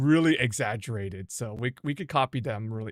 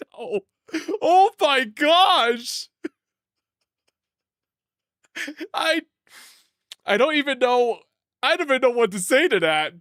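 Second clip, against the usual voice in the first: incredulous, laughing; 10 semitones higher, 3 dB louder than usual. Real, not acted.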